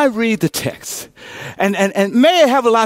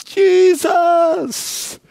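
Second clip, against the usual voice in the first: high cry of Jesus; lifted cry; 10 semitones higher than usual. Shown as a demonstration. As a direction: call out his name. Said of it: "Can somebody cry".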